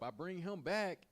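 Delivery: usual speaking voice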